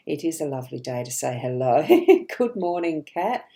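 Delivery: ordinary talk, nothing marked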